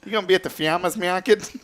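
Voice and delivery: high-pitched imitation Connecticut accent. Host mimics dad's friend from Connecticut, in accent. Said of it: "It's like a Connecticut accent".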